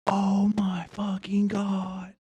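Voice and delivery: slow, breathless whisper of disbelief; awed whisper. Real, not acted. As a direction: whisper it in awe. Stunned by the new setup.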